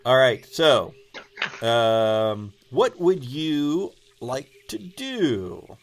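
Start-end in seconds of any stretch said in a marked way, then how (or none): none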